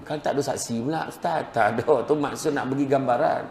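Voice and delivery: ordinary talk, nothing marked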